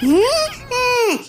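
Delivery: high, drawn-out mock sob; a theatrical whimper of hurt; hammed-up sob. Aunt hurt, fake sobbing.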